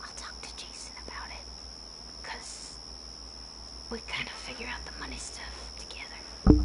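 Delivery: whispered aside; breathy private whisper. Real, not acted. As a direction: hush it like a secret. Aside about money.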